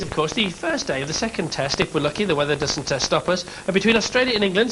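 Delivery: ordinary talk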